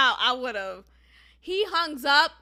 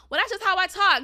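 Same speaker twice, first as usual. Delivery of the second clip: mock excuse; 5 semitones higher, 5 dB louder than usual. Imitating his excuse, then rebutting it.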